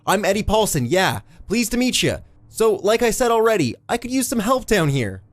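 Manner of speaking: high, exasperated call; calling back. A narrator's reading. Eddie asks the constable for help, calling back high. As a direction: call out for help, losing patience. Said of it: "Eddie called back".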